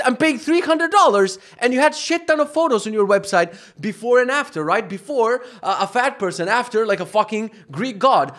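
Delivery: high, loud angry rant; furious rant. Real, not acted. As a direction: vent at the useless trainer. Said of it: "it's making me fucking mad".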